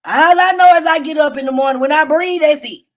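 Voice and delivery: ordinary delivery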